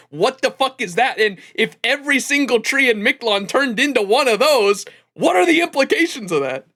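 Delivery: exasperated, high-pitched rant building to an outburst; losing my mind. Real, not acted. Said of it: "losing my mind when that happened".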